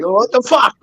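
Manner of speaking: high incredulous outburst; mock indignant kid. Kid shocked his dad sides with the teacher. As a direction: act stunned at the dad's answer. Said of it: "I'm like".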